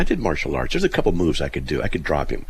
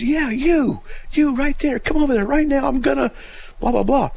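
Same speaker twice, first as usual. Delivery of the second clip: loud, very high mock yell; imitated yelling; 4 dB louder than usual. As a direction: imitate an enraged driver ordering someone over. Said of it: "This guy's yelling at me".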